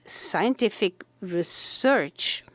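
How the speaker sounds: normal talking voice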